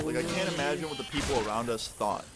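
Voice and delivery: ordinary talk, nothing marked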